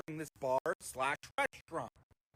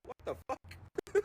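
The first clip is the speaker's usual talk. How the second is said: incredulous; 6 semitones higher, much slower than usual. Retold or imitated. Mocking drunk's lines.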